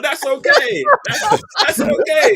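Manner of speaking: mock forgiving, laughing; sarcastic laughing. Real, not acted.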